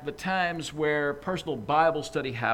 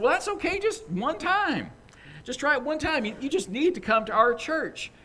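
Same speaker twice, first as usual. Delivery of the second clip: much higher, bright pushy church lady voice; eager church lady; 10 semitones higher than usual. Acted. Church lady urging her boss to come, high and eager. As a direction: press the invitation on him, chirpy.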